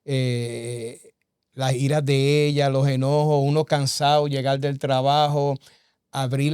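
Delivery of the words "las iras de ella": normal talking voice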